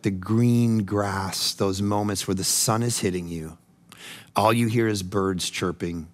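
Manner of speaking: low, soft, slow meditation voice; guided imagery. Real, not acted. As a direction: ease them into rest.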